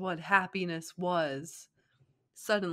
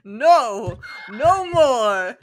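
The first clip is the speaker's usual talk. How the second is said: loud furious ramen man yelling no; bellowing shopkeeper refusal; angry shout; 5 semitones higher, 14 dB louder, much slower than usual. Acted. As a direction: shout him off, fed up. Ramen man slams hand down.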